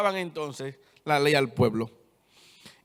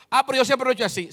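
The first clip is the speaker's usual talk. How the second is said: higher, louder, fast whiny excuse; mock objector; 7 semitones higher, 6 dB louder, much faster than usual. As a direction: toss off the excuse like a stubborn churchgoer. Mocking a stubborn objector. (imitando a un creyente terco que se excusa)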